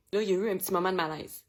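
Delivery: ordinary talk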